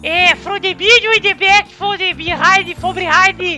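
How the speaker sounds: Brazilian mock English; broken English accent. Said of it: "dá um recado estilo Joel Santana".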